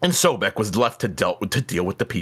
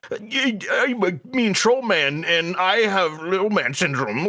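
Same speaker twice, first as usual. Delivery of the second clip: mean troll voice; 5 semitones higher than usual. Retold or imitated. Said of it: "What the fuck is this?".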